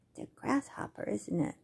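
soft breathy whisper of the answer. Acted. Telling the children the answer in a secret whisper. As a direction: let them in on a secret.

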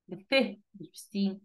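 usual speaking voice

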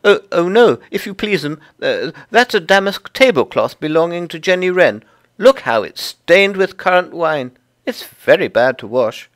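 high, loud little creature voice, stammering politely; flustered Tiggywinkle. Acted. Tiggywinkle introduces herself to Lucy, high and fluttery. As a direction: pipe up eagerly as a small humble laundress.

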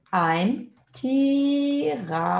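slow, drawn-out "ein Tiramisu", syllable by syllable; modelo pausado. Shown as a demonstration. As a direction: model "ein Tiramisu" slowly for learners, stretching each vowel. Teacher models the phrase slowly. ("ein Tiramisu" devagar, com sílabas alongadas)

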